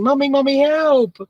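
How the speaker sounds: high, loud cry for mommy; shouting for help. Acted. Scared boy waking from a monster dream, shouting for mommy. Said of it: "so he was shouting".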